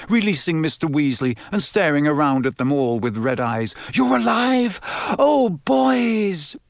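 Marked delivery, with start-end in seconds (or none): 3.9-6.6 high, emotional mother's cry; overwrought relief. A narrator's reading. Mother to her returned sons, high overwrought cry.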